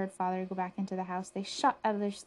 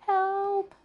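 high, loud cry for help; loud panicked yell, pitched way up; storybook scream; 13 semitones higher, 9 dB louder than usual. A narrator's reading. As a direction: scream for rescue. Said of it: "screamed Buster".

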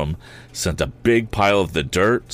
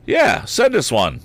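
teasing; 7 semitones higher, 4 dB louder than usual. A narrator's reading. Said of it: "added Tom".

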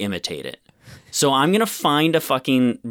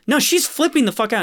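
higher, fast urgent protest; frantic mock insistence; 10 semitones higher, faster than usual. Mocking Denise Crosby's character protesting to the dad, higher and fast. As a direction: rush it out, alarmed. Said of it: "Denise Crosby is like".

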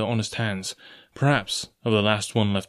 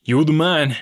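louder, higher exclamation; cried out; 5 semitones higher, 7 dB louder than usual. A narrator's reading. Coxswain hailing the ringleader, crying out. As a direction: exclaim it heartily. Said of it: "cries the coxswain".

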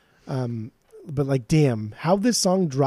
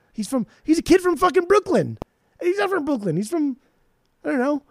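high-pitched, exasperated rant; worked-up, bewildered ranting; 10 semitones higher than usual. Real, not acted. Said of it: "I just hear you ranting".

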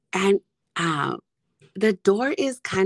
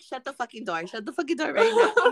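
laughing yelled order; 6 semitones higher, faster than usual. Retold or imitated. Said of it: "I'm like".

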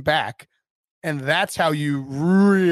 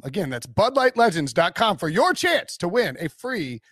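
upbeat pitchman ad read; 4 semitones higher than usual. Acted. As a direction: sell it like a radio spot. Continues the Bud Light ad read.

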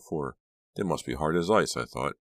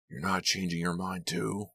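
angry snap; 4 semitones higher than usual. A narrator's reading. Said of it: "Lou snapped angrily".

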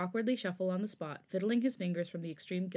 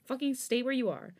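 angry warning; 6 semitones higher than usual. A narrator's reading. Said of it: "he warned".

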